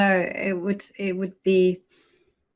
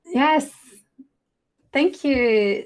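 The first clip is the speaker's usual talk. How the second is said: higher and louder burst of thanks; excited thanks; 7 semitones higher, 7 dB louder, much slower than usual. Real, not acted. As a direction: pop the yes up with real delight. Thanking chat again.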